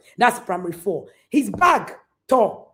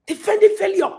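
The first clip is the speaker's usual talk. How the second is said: higher, louder echo of the phrase; emphatic repeat; 10 semitones higher, 5 dB louder than usual. Real, not acted. Preacher echoing her warning, higher and louder. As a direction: fling the phrase back at them.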